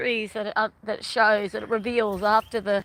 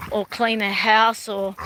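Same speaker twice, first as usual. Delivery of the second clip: normal talking voice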